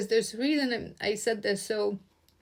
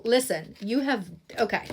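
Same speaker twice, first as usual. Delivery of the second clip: normal talking voice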